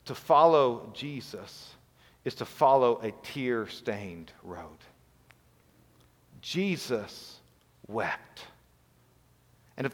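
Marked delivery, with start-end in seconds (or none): 0.1-4.8 slow, deliberate and soft; slow solemn. Real, not acted. Pastor telling his church the road is tearful, slow and soft. 6.4-8.5 softer, with a low sinking wept; hushed reverent. Real, not acted. Pastor quoting the shortest verse, hushed and reverent. Solemn scripture climax.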